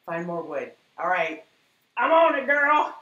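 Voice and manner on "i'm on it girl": high, loud, playful holler; enthusiastic shout. Real, not acted. Answering a viewer who wants more wood, playful shout. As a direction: belt it out eagerly. Calls out reply to viewer's request.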